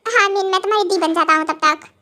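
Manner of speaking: normal talking voice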